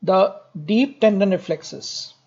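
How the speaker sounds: slow dictation. Shown as a demonstration. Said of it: "Write it down".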